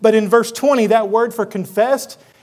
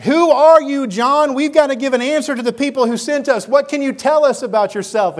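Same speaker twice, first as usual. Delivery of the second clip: higher, pressed, exasperated questioning; frustrated; 4 semitones higher than usual. Acted. Priests pressing John for an answer, frustrated. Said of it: "I can see them being a little frustrated".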